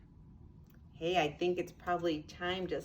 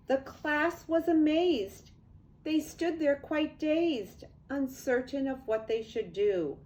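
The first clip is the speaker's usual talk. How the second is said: lively storybook read-aloud; sing-song picture book narration; reading to children; 4 semitones higher than usual. A narrator's reading. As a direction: read it like bedtime rhyme to kids.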